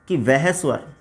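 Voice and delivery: slow, low-key dictation with long pauses. Acted. Teacher dictating the pluta vowel definition, measured dictation. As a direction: dictate it phrase by phrase, leaving writing time.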